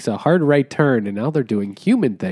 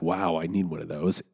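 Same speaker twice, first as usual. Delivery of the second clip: softer and lower "Wow, I need one of those" in quiet wonder; hushed awe; 6 semitones lower, 10 dB quieter than usual. Retold or imitated. Toad admiring the car that wrecked his carriage, hushed awe. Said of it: "he's like".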